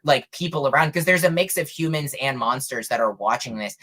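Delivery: usual speaking voice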